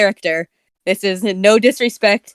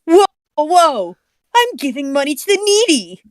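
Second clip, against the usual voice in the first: lightly voiced hero; 5 semitones higher than usual. Voicing hero in game.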